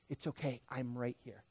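soft, low comforting words; calming reassurance. Acted. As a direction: soothe someone who is anxious. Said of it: "he calms you in that moment".

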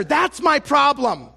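usual speaking voice

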